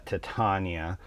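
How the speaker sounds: "Titania" as tih-TAHN-ya; "Titania" with short first vowel, TAHN stressed; the productions' pronunciation. Shown as a demonstration. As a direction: say "Titania" with a broad AH. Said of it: "they said".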